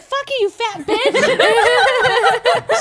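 slow, high, loud mock yell with a laugh; retold yell. Grandma cursing out the drive-thru worker, laughing mock yell. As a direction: drag it out like a furious grandma cursing through the window. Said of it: "and yelling".

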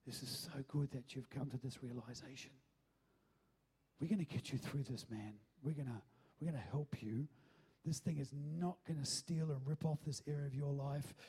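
soft, low, tender reassurance; hushed acted comfort, without real sobbing; imitated tearful encouragement. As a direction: speak low and gently to someone confessing. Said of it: "I start crying and going".

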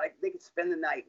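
normal talking voice

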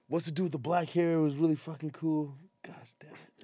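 unvoiced whisper, trailing to almost nothing; whisper to himself. Real, not acted. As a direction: mutter it under your breath while searching memory. Trying to recall a name.

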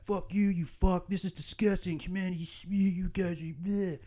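high, softened sputtering rant; mock troll rant. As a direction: imitate a disgusted online troll. Acts out online troll's rant.

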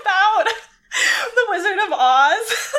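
giggling through words; laughing hard, high pitched; laughing while talking. Real, not acted. Laughing about favorite line.

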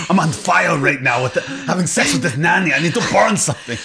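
hammed-up Arnold voice, laughing through it; mock Arnold. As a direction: play a manic Arnold on fire. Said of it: "nanny sex probably led to him being like".

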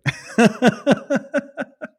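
high, breathy giggle; a short squeaky laugh. Real, not acted. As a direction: laugh it off lightly. Laughs at himself.

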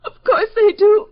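high, crying wail of indignation; tearful. Acted. Crying woman answering a question about her lashes, tearful. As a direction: wail it through tears. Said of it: "Are you starting to cry?".

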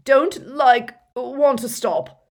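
loud, high, drawn-out moaning; anguished moan. A narrator's reading. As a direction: moan out in torment. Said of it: "moaned Dumbledore".

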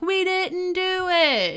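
high, drawn-out mock chorus; imitated crowd yell. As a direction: wail a denial like a whole trailer park. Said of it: "a hundred voices throughout the trailer park yelling".

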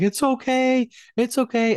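soothing newborn. Retold or imitated. New dad soothing his crying newborn daughter, high tender coo. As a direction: hush her gently in a lilting high voice. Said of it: "I'm trying to comfort her immediately".

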